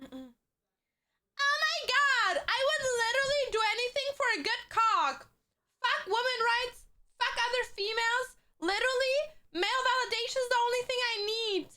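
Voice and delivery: put-on very high, squealing anime girl voice; Misa Amane. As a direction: gush like an obsessed anime girl. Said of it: "channeling my inner Misa".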